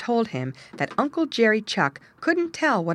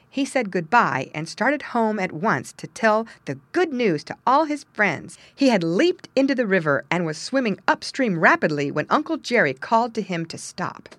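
usual speaking voice

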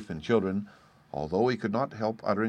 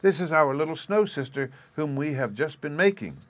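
put-on high-pitched, lightly amused little girl; mildly amused daughter; 7 semitones higher, 4 dB louder than usual. A narrator's reading. Daughter introduces the snow sister to mother, mildly amused. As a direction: explain it as something delightfully obvious. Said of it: "laughing to think that her mother did not comprehend".

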